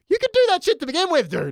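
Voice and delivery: high-pitched, fast exasperation; exasperated. Real, not acted. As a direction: rattle it off high in comic disbelief. Mock outrage at retold flirting.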